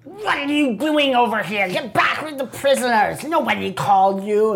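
snapping at an underling; a goblin leader scolding the troll; lightly voiced angry boss. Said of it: "and he's like".

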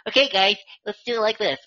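high squeaky mock newscaster; chipmunk voice. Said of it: "chipmunk voices".

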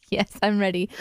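usual speaking voice

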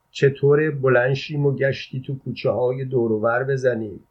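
plain reading voice

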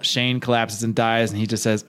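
normal talking voice

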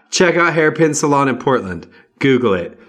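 loud sponsor plug; ad shout-out. Real, not acted. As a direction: plug the sponsor loudly to listeners.

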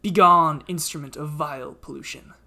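booming, angry ancient tree; loud, wrathful forest spirit; talking tree. Acted. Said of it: "the tree speaks".

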